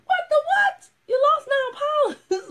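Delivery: high, loud shocked squeal. Real, not acted. Coach hearing her client lost nine pounds, shocked and squealing. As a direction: gasp it out in disbelief.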